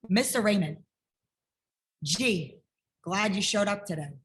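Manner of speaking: flat sarcastic greeting; insincere. Shown as a demonstration. Teacher demonstrating an insincere greeting. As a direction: say it like you don't mean it. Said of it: "Did that sound or look sincere?".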